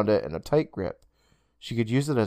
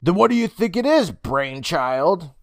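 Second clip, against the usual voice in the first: put-on higher girl's voice, sarcastic; mocking sister; 6 semitones higher than usual. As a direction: tease him for the dumb idea. Sister mocks brother.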